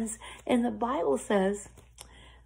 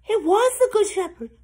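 high, loud rush of hope; excited realization; 13 semitones higher, 7 dB louder than usual. A narrator's reading. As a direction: tell it thrilled that rescue is coming. Lamb realizes rescue is coming.

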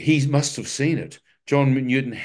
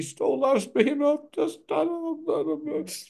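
much higher, slow, cracking voice; choked up. Retold or imitated. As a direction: stretch it out as if weeping. Retelling weeping before God.

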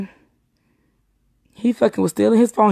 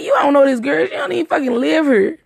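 high-pitched heated protest; retold indignant arguing; 6 semitones higher than usual. Woman retelling her argument with the man, indignant. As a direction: argue back at him, pitched up.

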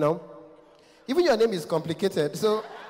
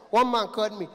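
ordinary delivery

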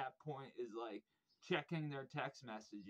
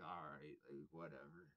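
resigned; 8 semitones lower, 10 dB quieter than usual. Retold or imitated. Giving in to drummer.

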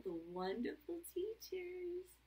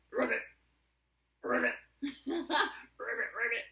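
short rolled croaks, broken by a laugh; frog ribbit; 11 dB louder than usual. Pretend. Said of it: "And the frog says".

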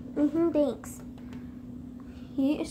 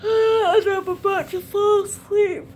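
mock whine; 5 semitones higher, 5 dB louder than usual. Fake sleepy whine.

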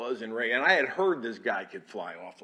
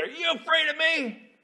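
much higher, angry challenge; furious demand; 5 dB louder than usual. Retold or imitated. Commander demanding of the chaplain, angry and high. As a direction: point and demand it in a rage. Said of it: "he's yelling and he's cussing".

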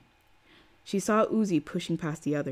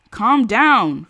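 shout; 5 semitones higher, 12 dB louder than usual. Acted. Someone in crowd shouts.

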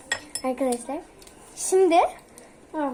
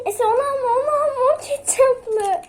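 urgent shout; 10 semitones higher, 6 dB louder than usual. Real, not acted. Warning sister about ketchup.

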